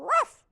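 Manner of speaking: single high, loud yap; puppy bark. Acted. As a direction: give one sharp puppy bark. Puppy barks in story.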